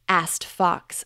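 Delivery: ordinary delivery, nothing marked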